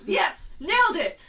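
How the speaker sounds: loud, high victory cheer; mock triumph. Fan celebrating breaking the ice, mock triumph. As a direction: celebrate at full volume, playfully.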